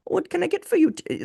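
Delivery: very high sing-song service voice; chirpy desk clerk, hammed up; mock hotel clerk. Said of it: "like a desk attendant at a Hilton hotel".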